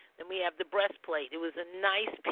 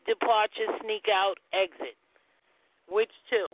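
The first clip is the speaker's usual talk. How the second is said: quiz host; 3 semitones higher than usual. Acted. Reading out quiz question.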